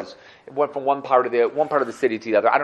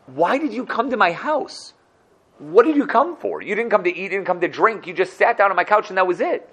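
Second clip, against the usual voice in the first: raised, pleading voice of a puzzled banker; pitched-up bewildered plea; pleading questions; 7 semitones higher than usual. Acted. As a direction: press him, baffled and a bit hurt. Manager pleading with the Rebbe.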